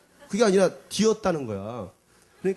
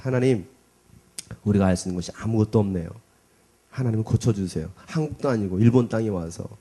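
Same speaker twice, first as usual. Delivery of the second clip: earnest prayer; 4 semitones lower than usual. Retold or imitated. Retold prayer over burned hand.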